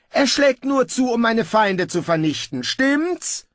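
high, loud ruler's voice; pompous ruler. Acted. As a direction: proclaim it high and loud, sure of your power. Pompous ruler seeks confirmation. (herrisch und selbstgefällig)

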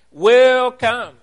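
"Will come" lifted high and loud, both words hit; emphatic will come. Shown as a demonstration. Preacher driving home Peter's point to the congregation, "Will come" high and loud. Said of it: "placed at the beginning often for emphasis".

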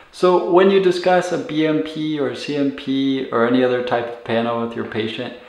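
normal talking voice

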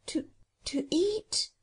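slow, high, hesitant question; frightened whisper. Acted. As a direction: falter on it, hushed and pitched high. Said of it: "whispered Jane".

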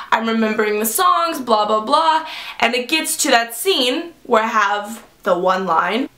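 usual speaking voice

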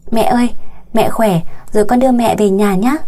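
usual speaking voice

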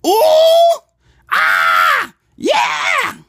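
high, loud squealing yells; excited screaming. Acted. Demonstrating annoying people screaming over nothing. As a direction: shriek like you just won something. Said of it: "When people scream like this".

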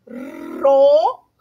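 "Rojo" split slowly with a long rolled R; sounded out. Shown as a demonstration. Teacher showing the two O's, "Rojo" sounded out slowly. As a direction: stretch "Rojo" into separate sounds, trilling the R.

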